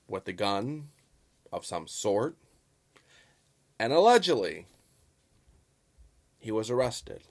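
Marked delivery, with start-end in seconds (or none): 3.9-4.7 "allegedly" lifted high and loud, then dropped; emphatic allegedly. Real, not acted. Vlogger stressing his fairness to viewers, emphatic allegedly. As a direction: punch "allegedly" as a pointed caveat. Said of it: "I emphasize the word allegedly".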